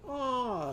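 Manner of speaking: high sustained "ah" easing down; doctor's-visit "ah". Shown as a demonstration. Ostrich saying it for the doctor, high held "ah". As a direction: open up and hold a high "ah". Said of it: "going to the doctor and saying".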